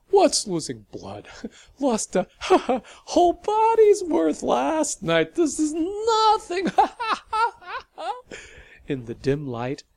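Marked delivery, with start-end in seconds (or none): 0.1-8.2 much higher and louder, broken by laughs; laughing through pain. Acted. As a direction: laugh hysterically despite the wound. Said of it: "it clearly hurt her to laugh".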